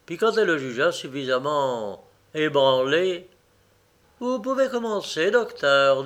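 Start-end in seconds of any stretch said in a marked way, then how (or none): 4.2-6.0 brusque, higher-pitched woman's order. A narrator's reading. Lady gives the surgeon the go-ahead, brusque. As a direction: give the go-ahead abruptly, pitched up. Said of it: "dit-elle brusquement".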